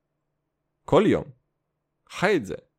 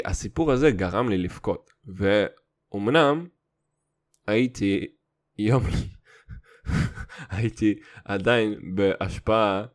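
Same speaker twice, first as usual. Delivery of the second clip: ordinary delivery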